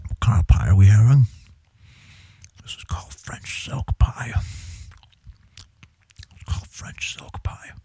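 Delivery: whispered close into the mic; ASMR whisper. Pretend. Streamer introducing French silk pie, ASMR whisper. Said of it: "A S M R stream".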